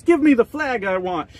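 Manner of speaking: hammed-up whiny quarterback; mock whiny yell. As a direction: whine it like a spoiled player. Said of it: "He starts yelling at refs".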